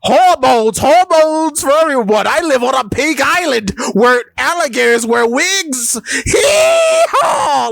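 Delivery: hammed-up shouting impression, very high-pitched; Ivankov. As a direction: proclaim it wildly, climbing to a yell. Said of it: "his second command goes".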